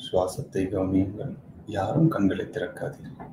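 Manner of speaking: soft, measured meditation guidance; quiet meditation-coach voice with long silences between instructions; guided meditation. Acted.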